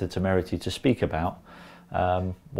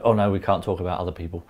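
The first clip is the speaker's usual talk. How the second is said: quick flustered brush-off; hurried backpedal; faster than usual. Retold or imitated. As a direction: rush it like a cornered boss. Boss backpedals hastily.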